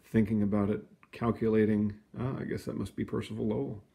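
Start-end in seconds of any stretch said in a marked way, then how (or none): none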